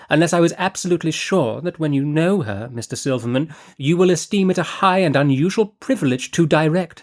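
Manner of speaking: breezy, scatterbrained noblewoman chatter; Lady Fareway. Acted. Narrator voices Lady Fareway.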